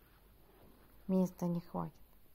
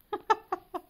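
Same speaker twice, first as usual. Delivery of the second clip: sudden high-pitched laugh; 13 semitones higher, 10 dB louder than usual. Real, not acted. (высокий смех)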